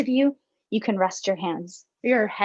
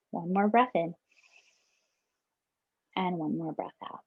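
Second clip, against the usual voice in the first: guided meditation. Acted. Facilitator closing a breathing exercise for teachers, calm meditation voice. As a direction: gently guide them back to the room.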